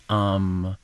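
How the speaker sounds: ordinary talk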